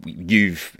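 ordinary talk, nothing marked

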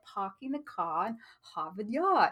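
hammed-up British accent without the R's; bad British accent. Shown as a demonstration. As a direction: put on a clumsy British accent, dropping every R. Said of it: "do my bad British accent".

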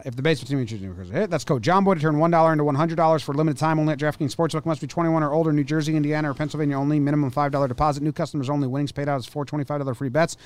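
rattled-off fine print, fast; fast legal disclaimer. Acted. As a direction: race through the legal terms. Rapid sponsor legal terms.

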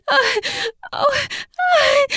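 high gasp and cry; gasping cries. Acted. Woman gasps and cries.

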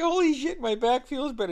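high, loud burst of surprise; amazed relief. Retold or imitated. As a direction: exclaim in happy disbelief. Retold amazement at healed back.

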